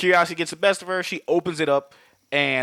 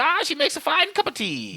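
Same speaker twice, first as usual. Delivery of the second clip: high, put-on Irish leprechaun accent; a cartoonish Irish leprechaun, pleased with himself; 14 semitones higher than usual. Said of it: "the leprechaun Irish accents".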